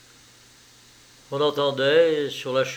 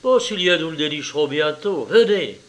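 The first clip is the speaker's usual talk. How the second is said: eager, raised-voice urging; urgent resolve; 3 semitones higher, 6 dB louder than usual. A narrator's reading. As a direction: rouse a companion to the hunt. Character urges friend to hunt upstairs.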